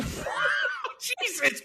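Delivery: long, high, drawn-out Jesus Christ; stretched-out high shocked cry. Real, not acted. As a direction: wail it out in disbelief. Shocked reaction to friend story.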